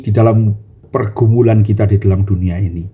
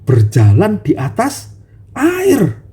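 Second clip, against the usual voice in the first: high, slow earnest longing; sincere Peter; 11 semitones higher, slower than usual. Acted. Peter asking Jesus, sincere and longing. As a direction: plead honestly to share the power. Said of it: "dia berkata".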